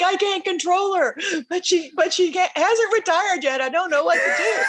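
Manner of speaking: high-pitched, hammed-up despairing complaint; mock helpless exasperation. Officer excusing his uncontrollable colonel, in mock helpless exasperation. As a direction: imitate a boss at wits' end.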